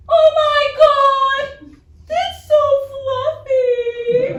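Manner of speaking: very high, slow, squealing delight; happy exclaim. Retold or imitated. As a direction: squeal like she found a puppy. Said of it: "The woman happily exclaims".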